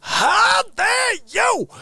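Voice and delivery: very slow, high, loud shouted how dare you; mock outrage. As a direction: drag out a hammed-up indignant shout. Mock outrage again, louder.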